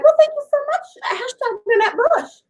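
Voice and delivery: high-pitched, gushy retold thank-you; gushing thanks. Host retells thanking an unknown gift sender, high mock gushing.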